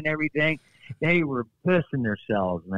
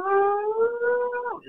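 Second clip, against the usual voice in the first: shrill falsetto yelp, far above his normal voice; highest-pitched cry. Retold or imitated. In the ring, selling the hold to the crowd with a falsetto cry. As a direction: fake a pain cry at the very top of his range. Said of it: "as loud as I could, man, at the highest pitched sound".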